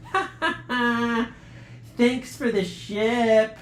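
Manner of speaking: a jeering ha ha ha, then slow, smug thanks; mocking laugh. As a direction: gloat over the trapped kids, slowly. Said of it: "it was a boy laughing".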